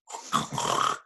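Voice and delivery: snorting pig noise; piggy snorts. Shown as a demonstration. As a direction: snort like a pig, then laugh. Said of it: "I'm gonna do it".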